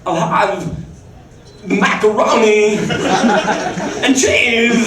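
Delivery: long pause, then a slow drawn-out macaroni and cheese; slow pausing imitation. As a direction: mimic his slow halting order. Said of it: "the way that I said it the first time".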